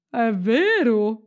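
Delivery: slow, swooping reindeer voice; drawn-out storybook reindeer; talking reindeer. A narrator's reading. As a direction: draw the question out like an animal character. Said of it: "disse la renna".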